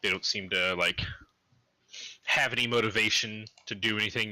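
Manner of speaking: ordinary talk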